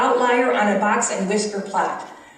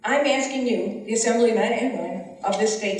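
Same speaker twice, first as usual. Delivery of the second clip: ordinary talk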